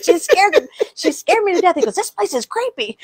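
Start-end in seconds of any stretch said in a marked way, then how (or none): 0.0-1.8 high, loud mock outrage; mad complaint. Scared delivery man complains to Dad, mock outraged. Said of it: "He was mad". 1.9-3.0 high, drawn-out mock disgust; mad complaint. Delivery man grumbles to Dad about the church, mock angry. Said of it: "He was mad".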